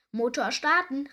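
loud storybook command; calling out. A narrator's reading. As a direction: call the order across the boat. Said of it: "rief Krake".

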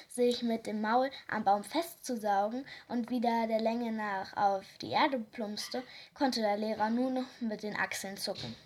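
plain reading voice

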